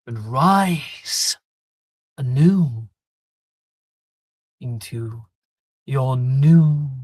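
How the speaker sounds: ritual command. Acted.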